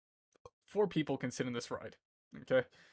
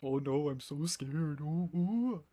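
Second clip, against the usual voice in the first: higher, slow, hammed-up fright; goofy mock scared; 6 semitones higher, much slower than usual. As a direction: play at being scared, goofy. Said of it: "I get really goofy and I start laughing".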